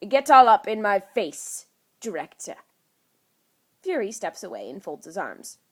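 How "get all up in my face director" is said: indignant. A narrator's reading. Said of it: "Loki backs away indignantly".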